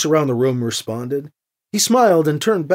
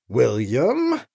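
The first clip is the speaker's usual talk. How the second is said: lower, warning rise on the name; displeased; 5 semitones lower than usual. A narrator's reading. Anita reproves Gentry with just his name, displeased. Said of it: "that tone of voice ... volumes of displeasure".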